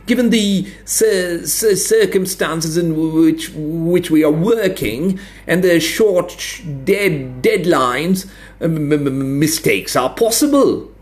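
high, halting, flustered stammer. A narrator's reading. Flustered expert stammering excuses to the administrator. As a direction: stumble over the words, embarrassed. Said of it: "stammered a beet-faced Nichols".

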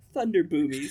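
loud, high imitation British accent; mock-British squeak; high-pitched British voice. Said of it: "a high-pitched British voice".

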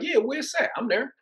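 very high, keen acceptance; high thrilled answer to the coach's invitation; eager yes. Retold or imitated. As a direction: jump at the offer. Retold eager reply to coach.

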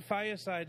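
"Fireside" said as fiyah-side; ethnic-sounding Fireside. Real, not acted. Friend recounting lunch to podcast mates, "Fireside" as fiyah-side. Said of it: "why are you saying Fireside".

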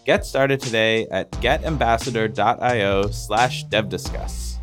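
ordinary talk

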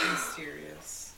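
dreamy sigh. Pretend. Swooning over book cover hero.

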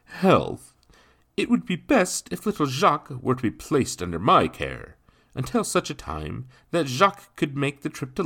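high, loud, lilting formal voice; officious gentleman. Acted. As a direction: explain grandly as a self-important gentleman.

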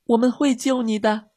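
put-on high, earnest child's call; shouting. A narrator's reading. Girls promising rescue to the dog, shouting. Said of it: "米莉和茉莉喊道".

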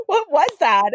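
high, laughing exclamation; laughing disbelief. Retold or imitated. Narrator answering the girl, laughing in disbelief. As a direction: laugh it out incredulously.

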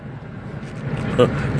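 a short, soft, bitter laugh under the breath; a low bitter chuckle; quiet scoff laugh. Real, not acted. Scoffing at his claim.

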